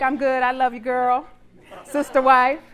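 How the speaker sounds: loud, sassy mock confidence; playful, punchy comeback; breezy retort. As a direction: brush off a rival cheerfully. Acts breezy retort to rival wife.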